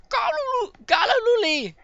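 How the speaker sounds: high, loud war cry in gibberish; battle yell. Acted. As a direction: yell it out like a fighter raising his mace. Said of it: "he just yells".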